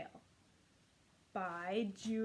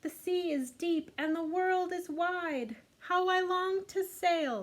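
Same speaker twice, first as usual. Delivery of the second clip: a touch higher, wistful little snail; tiny snail longing; 9 semitones higher, 8 dB louder, slower than usual. Acted. Snail telling the sea about its wish to sail. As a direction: sigh out the dream of sailing. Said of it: "said the tiny snail".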